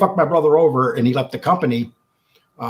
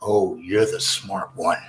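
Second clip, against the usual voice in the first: low, slow mock menace; low slow sarcastic sneer; sneering Vince; 8 semitones lower, 3 dB quieter, slower than usual. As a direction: imitate a condescending boss, lowered and slowed. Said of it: "Vince looked at me with that look and he goes".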